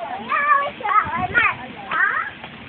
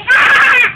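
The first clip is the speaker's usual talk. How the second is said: loud scream; 5 semitones higher, 10 dB louder than usual. Real, not acted. Child screams while playing, loud scream. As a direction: let out a drawn-out scream.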